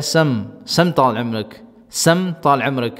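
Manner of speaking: slow, careful سم طال عمرک with a doubled m; Gulf reply. Shown as a demonstration. Teacher modelling the polite answer to a call. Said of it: "تو یہ کہتا ہے".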